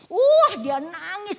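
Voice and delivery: high, loud, slow exclaim; stretched-out preacher's exclamation; preaching outburst. Acted. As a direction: cry it out slowly to the church.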